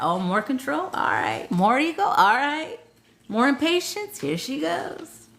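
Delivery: high, sarcastic commentary from the spirits; a weary team rolling their eyes, voiced high; mock spirit team. Spirit team mocking an impatient client, sarcastic. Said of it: "They're like".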